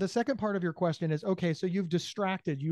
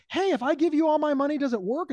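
loud eager question; 8 semitones higher, 4 dB louder than usual. Retold or imitated. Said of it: "then you can be like".

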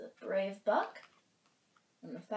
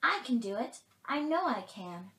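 louder, lightly voiced brave fawn; eager fawn; 4 semitones higher, 7 dB louder than usual. A narrator's reading. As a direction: insist mildly as a young volunteer. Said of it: "volunteered the fawn".

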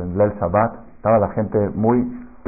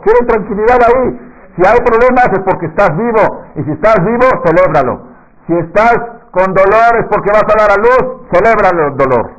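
much higher and louder exhortation; fiery preaching; 8 semitones higher, 6 dB louder than usual. Acted. Rabino exhorta a la congregación, voz alta y encendida. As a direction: rouse the congregation to celebrate.

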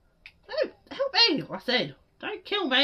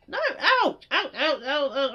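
usual speaking voice

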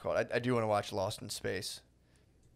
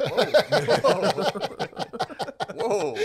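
high, loud, startled whoas; hammed-up imitation of taken-aback friends; mock alarm; 13 semitones higher, 6 dB louder, much slower than usual. Friends reacting to the plot, high mock alarm.

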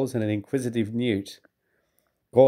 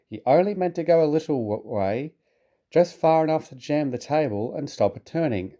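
ordinary delivery, nothing marked

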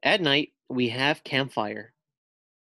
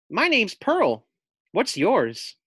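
high, bright little pug voice; a lightly voiced eager pug; friendly pug; 8 semitones higher, 4 dB louder, slower than usual. Pug greeting the new camper. Pug Pearl introduces herself.

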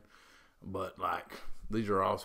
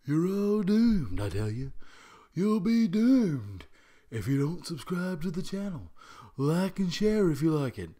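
Crazy Ralph; 8 semitones higher, 8 dB louder than usual. Retold or imitated. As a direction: warn of doom like a crazed old prophet. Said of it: "call in a guy ... Crazy Ralph".

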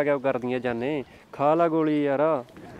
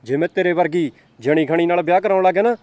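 loud, higher contemptuous yelling; angry shout; 3 semitones higher, 6 dB louder than usual. Acted. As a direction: put her down with a raised voice. Boyfriend angrily insults girlfriend. (ਗੁੱਸੇ ਵਿੱਚ ਉੱਚੀ ਝਿੜਕ)

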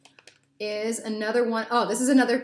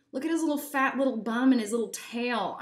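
ordinary delivery

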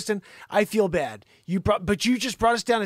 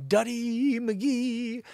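slow, high singsong taunt; mocking nickname; 7 semitones higher, much slower than usual. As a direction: stretch the name out high and teasing.

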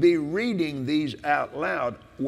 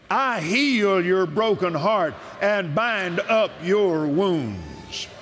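preaching at full force; 3 semitones higher, slower than usual. Acted. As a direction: shout the promise like from the pulpit.

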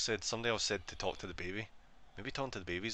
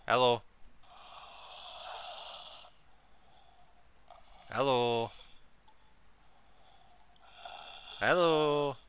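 loud hellos, last one higher; calling out; 4 semitones higher, 4 dB louder, much slower than usual. Real, not acted. Player trying to rouse the baby, calling out. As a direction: keep hailing it loudly.

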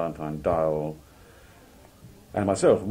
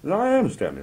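high, loud insistence on being Stanley; emphatic self-identification; 11 semitones higher, 5 dB louder than usual. Acted. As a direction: insist indignantly, pitched way up. Acted quote, Stanley insists who he is.

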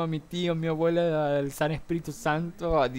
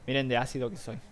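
ordinary talk

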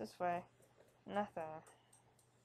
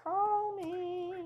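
mock flirty; 10 semitones higher, 4 dB louder than usual. Teasing joke, then "just kidding.

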